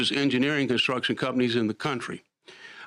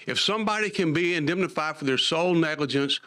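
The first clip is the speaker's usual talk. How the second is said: ordinary talk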